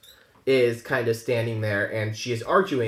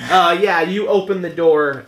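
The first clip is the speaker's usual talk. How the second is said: ordinary talk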